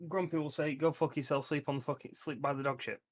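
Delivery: ordinary delivery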